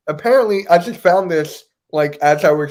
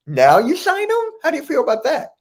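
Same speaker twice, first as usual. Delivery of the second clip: high, disbelieving jab at the front office; incredulous mock question; 9 semitones higher, faster than usual. Fan mocking the team for signing him so late, in a high incredulous voice. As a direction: ask it like you can't believe the timing.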